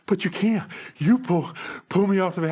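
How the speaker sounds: imitating a gasping, indignant runner; breathless protest. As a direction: sputter in outraged disbelief. Winded runner protests being out.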